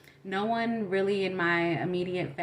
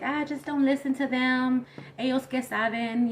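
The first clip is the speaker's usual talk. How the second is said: higher, brushing-it-off mom voice; dismissive mom; 6 semitones higher, 3 dB louder than usual. Retold or imitated. Mom telling her to ignore classmates, dismissive. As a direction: imitate a mom waving the worry away.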